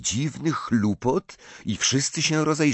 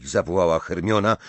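ordinary delivery

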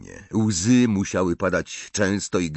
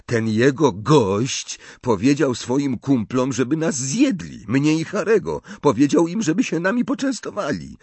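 indignant Ron; 6 semitones higher than usual. A narrator's reading. (oburzony Ron, wysokim, podniesionym głosem)

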